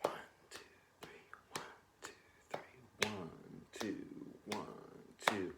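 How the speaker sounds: soft whispered counting; counting along. Shown as a demonstration. Teacher softly counting along while playing. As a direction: count under your breath in time. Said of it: "three in the left hand".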